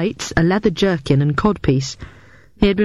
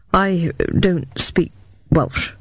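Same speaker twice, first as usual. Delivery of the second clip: ordinary delivery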